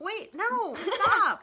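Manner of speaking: high loud laughing protest; laughing stop. Real, not acted. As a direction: call it off laughing. Mother stopping recording laughing.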